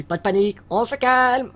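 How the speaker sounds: loud, high call for calm; hammed-up crowd-calming yell; mock panic control. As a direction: shout everyone down jokingly. Mock calming of panic after bomb joke.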